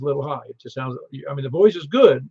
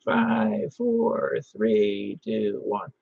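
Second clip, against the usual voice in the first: countdown from farther off; 3 semitones lower, much slower than usual. Retold or imitated. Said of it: "Take it about double that length".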